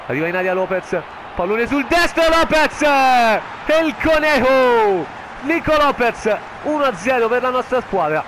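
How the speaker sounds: rising excited play-by-play, loud; breathless italian goal commentary. Acted. Live goal call in FIFA. (telecronaca concitata del gol)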